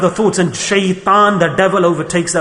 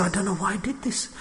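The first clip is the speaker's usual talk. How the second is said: much softer, lost confession; bewildered remorse; 13 dB quieter than usual. Retold or imitated. Jailed murderer telling interviewer, soft bewildered remorse. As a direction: murderer in jail, hushed regret. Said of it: "they say".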